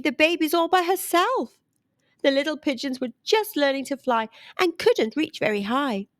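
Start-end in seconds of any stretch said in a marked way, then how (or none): none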